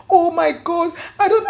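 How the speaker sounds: high tearful voice, crying sound; retold crying. Friend retelling how the pregnant woman cried. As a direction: imitate her crying and choking up.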